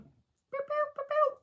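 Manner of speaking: high sung beeps like a detector; high mock detector boops; beep imitation. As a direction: imitate the scanner beeping.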